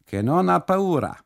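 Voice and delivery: custodian speaking Italian. Acted. Custodian insists to the trembling boy, loud Italian voice. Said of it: "sagt der Kustode".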